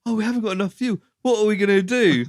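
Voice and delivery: high, hammed-up fretful wail; mock panic. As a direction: mimic her flapping about the fuel. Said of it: "she's like".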